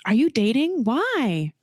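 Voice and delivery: mock incredulous question. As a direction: imitate someone pouncing with a nosy question. Acts out Kimberly questioning her date.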